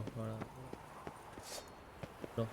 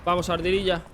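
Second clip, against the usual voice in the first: louder, higher urging call; loud cheering; 7 semitones higher, 7 dB louder than usual. Real, not acted. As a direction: shout encouragement at the screen. Cheering character through danger.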